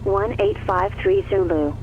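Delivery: normal talking voice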